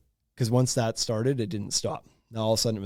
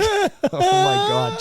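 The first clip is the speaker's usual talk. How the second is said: mock horror; 5 dB louder, much slower than usual. Parents smell poop in car.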